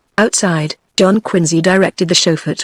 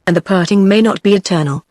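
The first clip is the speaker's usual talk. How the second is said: ordinary delivery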